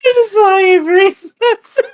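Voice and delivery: laughing through the words; giggling, can't keep a straight face; stifled giggles. Real, not acted. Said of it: "It's hard to keep a straight face".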